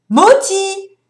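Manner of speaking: high, loud "Moti!" across the street; calling out. Retold or imitated. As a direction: call out to an old friend. Un passant l'appelle. (un passant qui appelle son ami, fort)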